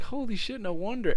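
high, impressed exclamation; retold amazed realization. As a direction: say it high, as if it just clicked. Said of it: "I was like".

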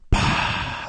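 voiceless puff of exhaled smoke; imitated breath puff. Imitating a smoker blowing in his face, a puff. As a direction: blow a quick puff of air. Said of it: "some dude would be like".

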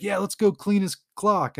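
high, eager mock battle cry; retold yelling. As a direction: imitate one of a rowdy gang egging the others on. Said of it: "they're all yelling".

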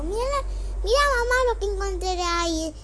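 mira, mira más agudo y fuerte, con emoción; llama a mamá entusiasmada; llamando emocionada. Acted. (la nena llama a mamá, emocionada y aguda)

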